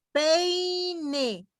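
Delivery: "peine" agudo y alargado, con la I resaltada; ejemplo marcado. Shown as a demonstration. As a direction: di "peine" alto, estirando la I. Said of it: "Fíjense". ("peine" agudo y lento)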